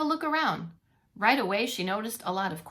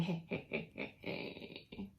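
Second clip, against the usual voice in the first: whispered calling; 5 semitones lower, 16 dB quieter, much slower than usual. Retold or imitated. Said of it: "She's saying".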